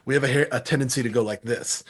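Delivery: ordinary delivery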